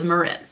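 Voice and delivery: Marin with stress on RIN; corrected pronunciation. Shown as a demonstration. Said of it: "It is Marin".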